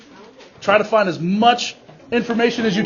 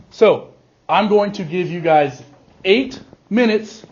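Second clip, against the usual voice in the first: usual speaking voice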